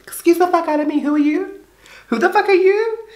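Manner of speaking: very high-pitched, affronted comeback; indignant retort. Acted. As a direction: act outraged in a high voice. Acted indignant retort to the critics.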